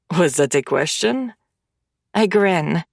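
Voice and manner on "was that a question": usual speaking voice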